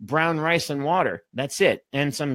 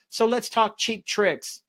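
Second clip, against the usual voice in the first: excited; 7 semitones higher than usual. Real, not acted. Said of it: "I'm getting too excited".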